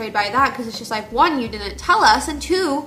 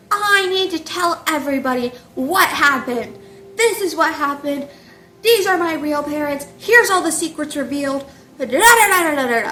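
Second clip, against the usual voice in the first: loud, higher-pitched mock tirade; hammed-up angry public announcement; really angry; 4 semitones higher, 5 dB louder than usual. Hollyleaf exposing secrets at the gathering, loud mock anger. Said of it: "really angry".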